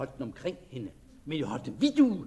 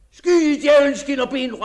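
high furious yell cursing the servant; shout; 9 semitones higher, 15 dB louder than usual. Acted. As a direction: roar it in desperate anger. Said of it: "råbte han til Jens".

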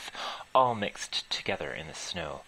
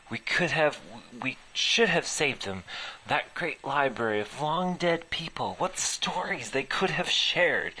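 higher, louder, fervent; impassioned lament; 7 semitones higher, 4 dB louder than usual. Acted. Laura laments the lost library to the head. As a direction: plead it out, rising in passion.

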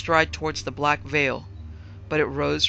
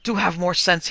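loud, higher exasperated cry; 3 semitones higher, 9 dB louder than usual. A narrator's reading. Father to hysterical daughter, crying out. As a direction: call out sharply to bring her to her senses. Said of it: "he cried".

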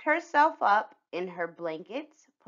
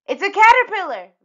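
loud, high excited blurt; shouting; 13 semitones higher, 13 dB louder, faster than usual. Acted. Girl shouting an answer out of turn in class. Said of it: "Vanessa shouted".

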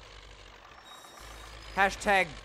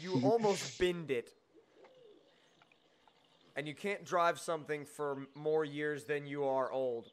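ordinary talk

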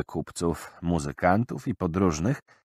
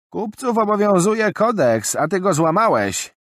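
high, loud formal verdict; stern old merchant woman; 13 semitones higher, 7 dB louder than usual. Acted. Old woman declaring Jarak broke the code, stern. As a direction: pronounce judgment firmly. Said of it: "oznajmiła kobieta".

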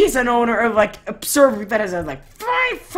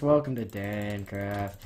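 deadpan sarcasm; 12 semitones lower, 9 dB quieter, slower than usual. Real, not acted. Sarcastic repeat of server greeting.

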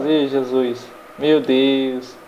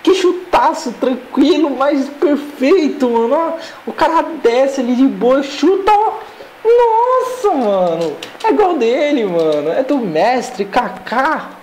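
empolgado e agudo; 8 semitones higher than usual. Real, not acted. Exaltando o gol do Kaká.

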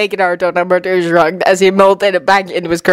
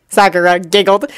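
ordinary delivery, nothing marked

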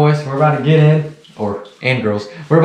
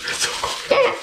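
breathless shivering whisper; 8 dB quieter, slower than usual. Real, not acted. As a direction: whisper it shaking from cold. Sitting in ice water.

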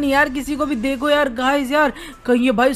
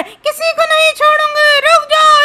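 slow, high shriek into a cackle; menacing ghost lady; 14 semitones higher, 5 dB louder than usual. Acted. Ghost lady chases the friends, menacing drawn-out shriek and laugh. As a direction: draw out the threat, then cackle.

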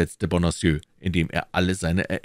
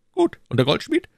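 higher, pressing questions as the Cardinal; Cardinal questioning; 13 semitones higher, 5 dB louder than usual. Acted. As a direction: interrogate his agent coolly. (der Kardinal, verhörend)

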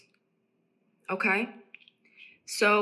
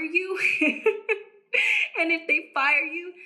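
high, amused laugh through the words; 8 semitones higher than usual. Real, not acted. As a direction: break into laughter mid-thought and carry on laughing. Laughs at being fired.

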